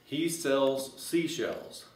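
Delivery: "He sells seashells" said slowly, each word spaced out; slow, deliberate first run at the tongue twister; careful tongue twister. Shown as a demonstration. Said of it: "you can say this real fast".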